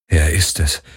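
low, half-disbelieving murmur; understated disbelief. A narrator's reading. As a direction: say it low, not quite believing it. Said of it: "halb ungläubig".

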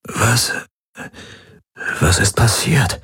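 halting, feeble question; weak waking voice. A narrator's reading. Groggy patient asks Kirai what happened, weak and halting. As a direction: grope slowly for the words. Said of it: "Er räusperte sich".

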